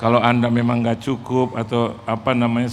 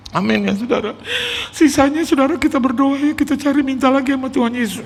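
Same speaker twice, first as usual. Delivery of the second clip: high, put-on preaching plea; imitated fervent pastor; 11 semitones higher than usual. Pastor urges congregation to keep praying, high fervent imitation. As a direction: exhort them in a high, overwrought voice.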